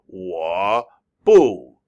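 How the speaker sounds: WA drawn out low, then BU falling loud; slow, over-careful WA, BU; real slow. Shown as a demonstration. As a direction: exaggerate each tone, one word at a time. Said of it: "speak real slowly and go".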